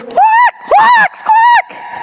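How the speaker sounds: loud, piercingly high bird squawks; imitated squawk, high and loud; squawking bird noise. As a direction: screech like tormented caged birds. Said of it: "this terrible squeaking noise".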